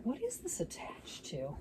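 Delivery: whispered puzzled aside. Real, not acted. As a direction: breathe it to yourself.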